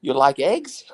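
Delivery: high, rising Chinese-accented question; mock Chinese accent. Shown as a demonstration. Dad teasing his kids at home, mock Chinese accent. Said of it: "I do a little Chinese accent".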